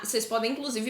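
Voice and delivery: normal talking voice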